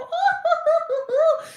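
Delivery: shrieking giggle way up high; high squealing laugh. Pretend. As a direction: let out a delighted squeal of laughter. Squealing at imagined fans.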